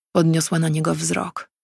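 usual speaking voice

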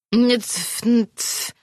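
high, small creature mumble; lightly voiced pet grumbling; mumbled creature voice. A narrator's reading. Pet creature mumbling with a ring in its mouth, high and small. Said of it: "wymamrotał".

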